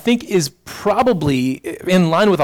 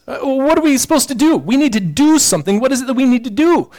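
higher, faster, insistent questioning; eager crowd; 8 semitones higher, 3 dB louder than usual. Retold or imitated. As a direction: push the question like the crowd demanding a task.

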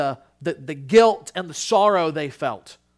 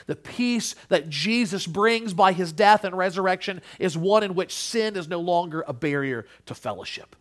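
normal talking voice

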